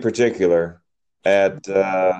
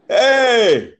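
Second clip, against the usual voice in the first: a sharp, much higher "Hey!" yelled from the stands; mock fan yell; 11 semitones higher, 4 dB louder than usual. Host imitating a soccer fan at the referee, mock yell. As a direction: bark it like a fan yelling at the ref.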